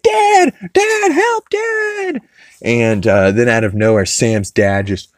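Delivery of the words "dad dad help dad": very high shrill mock cry for Dad; Chris crying for help. Chris in a chokehold, squealing for his dad. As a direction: squeal for help like a panicked kid. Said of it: "And he's like".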